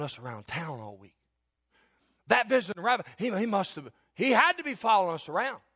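lightly voiced churchgoer, rising in wonder; a hint of a stunned worshipper telling his wife; a man walking out of church amazed; awed churchgoer. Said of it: "said something like this".

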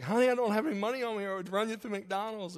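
high, fast flustered reply; caseworker. Retold or imitated. Caseworker answers hungry child.

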